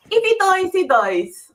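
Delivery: lightly accented Drago line; Russian accent. Shown as a demonstration. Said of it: "could you say".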